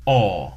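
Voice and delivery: "aw" as a rounded vowel; British O sound, "aw". Shown as a demonstration. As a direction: round the lips on "aw". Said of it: "the British O sound is rounded".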